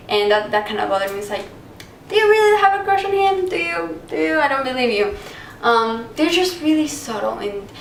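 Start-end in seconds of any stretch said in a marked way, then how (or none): 2.1-5.2 high-pitched sceptical questioning; playful high-voiced interrogation; mock doubting. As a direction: challenge them in a high teasing voice. Mock challenge to the subtle acting.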